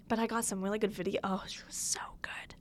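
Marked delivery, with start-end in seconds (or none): 1.2-2.5 hushed, reverent whisper; awed whisper. Real, not acted. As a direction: whisper it, still starstruck. Raving about the concert.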